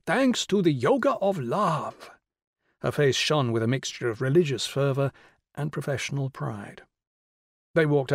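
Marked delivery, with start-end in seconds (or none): 0.1-2.1 high, slow, lilting triumph; exultant woman's voice, pitched up; triumphant. A narrator's reading. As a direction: draw each word out proudly in a raised voice. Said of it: "said Missus Rao triumphantly".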